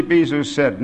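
ordinary delivery, nothing marked

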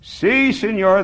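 high, loud, eager agreement; crying out. A narrator's reading. Old man answers Bisou's shout, crying out. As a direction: cry it out to agree. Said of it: "the man cried".

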